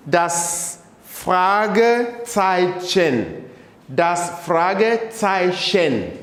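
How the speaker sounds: Fragezeichen stretched slowly, clear ts sound; slow model pronunciation. Shown as a demonstration. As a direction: drill it slowly for the class. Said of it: "pronounce them again".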